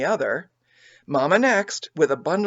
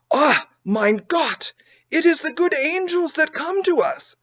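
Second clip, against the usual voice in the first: German, tears of joy; 11 semitones higher, 4 dB louder than usual. A narrator's reading. Poor German woman crying with joy at helpers. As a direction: cry out in joyful disbelief. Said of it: "cried the poor woman with tears of joy".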